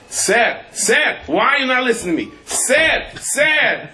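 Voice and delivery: high, insistent, rising sirs; pleading foreigner. Retold or imitated. Israeli man at the bank calling the teller sir, high and insistent. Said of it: "he goes".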